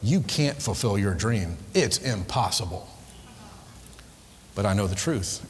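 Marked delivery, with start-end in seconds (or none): 0.0-2.9 discouraging inner voice. Retold or imitated. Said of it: "that voice comes back to me again and says".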